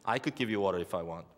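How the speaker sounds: quick, low, smug brag; boasting. Retold or imitated. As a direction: toss it off like a boast. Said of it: "it sounds like he's boasting".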